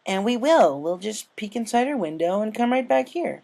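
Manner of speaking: put-on young filly voice. Scootaloo voiced.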